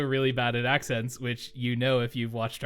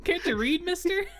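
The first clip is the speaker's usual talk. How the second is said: street urchin voice. Acted. Joins the urchin game.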